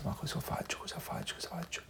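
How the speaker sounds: soft whispered worry to himself; desperate whisper. Retold or imitated. Sitting alone on a park bench, anxious whisper. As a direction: whisper it, lost and helpless.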